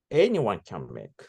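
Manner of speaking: normal talking voice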